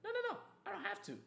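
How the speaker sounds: high-pitched dismissive mock reply; mock refusal. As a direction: brush them off in a high voice. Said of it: "It's like".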